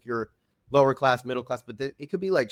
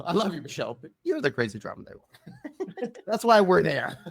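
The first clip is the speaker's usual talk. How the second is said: laughing; 6 semitones higher than usual. Real, not acted. Teasing friend, laughing through it.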